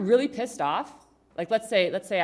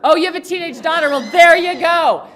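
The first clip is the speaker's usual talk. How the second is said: loud exclamation; 9 semitones higher, 17 dB louder than usual. Real, not acted. Speaker to a parent of a teenage daughter, loud exclamation. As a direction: exclaim it big to the whole room. Delighted reaction to audience member's answer.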